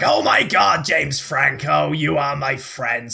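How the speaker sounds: general American. Acted. [name] greets his friend James Franco, attempted American accent. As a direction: put on a rough American accent, slowing down. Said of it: "do general American kind of thing".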